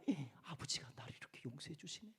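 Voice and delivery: soft, low, half-whispered thought; acted awed murmur to oneself; hushed wonder. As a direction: marvel under your breath. Prodigal son marvels to himself.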